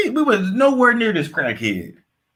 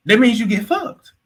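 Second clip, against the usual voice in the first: ordinary talk, nothing marked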